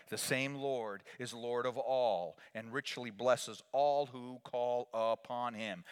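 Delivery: normal talking voice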